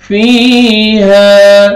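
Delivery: فيها with both fi and ha drawn out long; long vowels. Shown as a demonstration. Teacher demonstrating long vowels in فيها to students. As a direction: stretch فيها slowly, each long vowel held. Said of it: "ہا کو آپ نے کھینچنا ہے".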